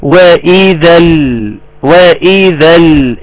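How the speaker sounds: "وإذا ال" slow, held on a clear l; clear still lam. Shown as a demonstration. Teacher making the lam clear for students, clear still lam. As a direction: draw out "وإذا ال" and rest on the lam. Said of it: "لازم نوضح اللام بقى".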